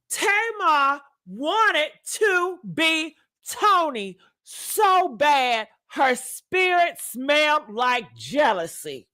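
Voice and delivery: slow, weighty, wanted leaned on; said with my chest. Shown as a demonstration. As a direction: deliver it bold and slow, full of conviction. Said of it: "Let me say it with my chest".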